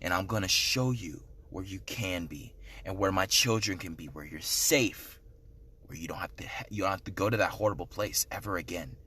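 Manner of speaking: very deep and soft, a distant otherworldly boom; the Lord's voice. Retold or imitated. The Lord promising to show him paradise, in a very deep distant voice. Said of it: "He's like".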